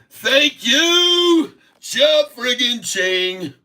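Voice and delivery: high whooping cheer, slow on the last words; cash-register glee; excited cheer. Real, not acted. Host cheering his fill price to viewers, high and excited.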